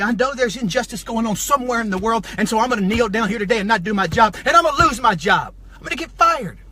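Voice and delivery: loud, heated imagined declaration; fired-up rant in a worker's voice; worked-up protest. Acted. Acts out protesting at work, riled.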